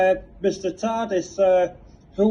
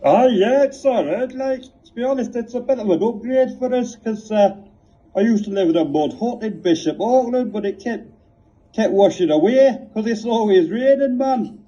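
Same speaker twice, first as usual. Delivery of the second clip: higher, chatty northern lad; northern caller Graham; 5 semitones higher than usual. Acted. Graham answering the igloo question, cheery northern voice. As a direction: play a cheery lad from Bishop Auckland. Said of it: "Graham, uh, Dipthong".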